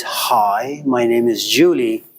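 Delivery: low, pleasant introduction; nice tone of voice. Shown as a demonstration. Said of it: "her voice is".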